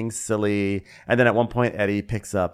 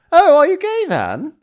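much higher, louder squeaky question; mock Jake; 10 dB louder than usual. Mimicking Jake asking Fraser, high loud squeak.

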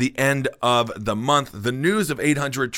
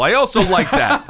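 laughs the words out, higher and brighter; laughing; 8 semitones higher, 5 dB louder than usual. Real, not acted. Host laughs at his own joke, talking through a laugh. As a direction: chuckle through the punchline.

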